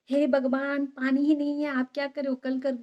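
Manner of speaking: put-on high, loud exasperated scold of the elderly parent; loud exasperated "हे भगवान" softening to a firm "आज नहीं". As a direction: throw up your hands, no water left, stop him. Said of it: "बाबा को ऐसा कहा".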